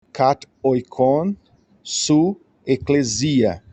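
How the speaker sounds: "kat oikon su eclesia" read slowly, word by word; slow Greek reading. Shown as a demonstration. Teacher reading the Greek verse aloud for the class, slowly. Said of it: "teacher reading the Greek text".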